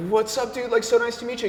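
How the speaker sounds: high, eager mock greeting to your idol; light up as if meeting your hero; excited greeting. Greeting his idol, retold.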